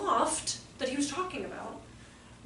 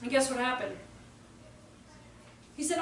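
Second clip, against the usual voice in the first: normal talking voice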